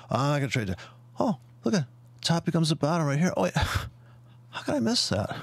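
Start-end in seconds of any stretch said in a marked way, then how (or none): 3.3-5.3 mock realization. Mocking a trader's realization.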